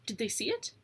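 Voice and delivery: plain reading voice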